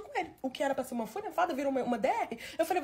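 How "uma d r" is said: usual speaking voice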